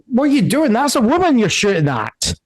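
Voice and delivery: high-pitched alarmed protest; agitated man. Acted. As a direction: pipe up in urgent objection. GM voices man shouting in scuffle.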